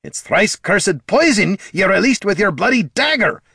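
high, heated angry snap; snapped. A narrator's reading. Dwarf blaming the bard for poison gas, snapped. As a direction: snap it accusingly. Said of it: "snapped Gorm".

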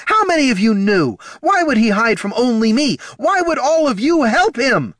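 high, loud, furious questions; betrayed, shrieking outrage; screaming. Acted. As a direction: scream each accusation. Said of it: "screamed Katha".

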